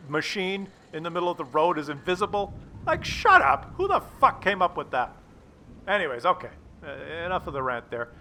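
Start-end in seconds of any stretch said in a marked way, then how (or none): 2.8-5.1 high, loud exasperated shut up; indignant squawk; outraged. Real, not acted. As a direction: yelp it, fed up with the game. Rant peaks.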